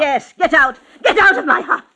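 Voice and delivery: loud, high, raging; enraged command; furious shout. Acted. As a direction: drive them out of the house. Old woman ejects them again.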